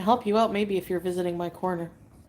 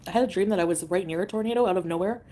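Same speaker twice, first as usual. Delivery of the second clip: ordinary delivery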